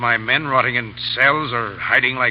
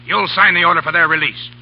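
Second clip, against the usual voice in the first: threatening; 4 semitones higher, 7 dB louder than usual. Acted. Pirate threatens general at gunpoint.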